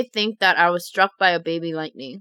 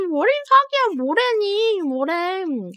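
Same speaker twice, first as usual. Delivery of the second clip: mocking parent; 10 semitones higher, faster than usual. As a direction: scoff at the child's wild story. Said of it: "They start laughing".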